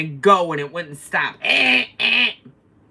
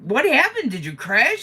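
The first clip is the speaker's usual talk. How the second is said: surprised bus lady; 8 semitones higher, 4 dB louder than usual. Retold or imitated. Bus lady noticing scooter damage.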